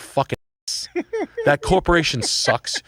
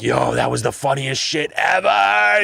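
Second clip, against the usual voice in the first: excited friend; slower than usual. Retold or imitated. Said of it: "He's like".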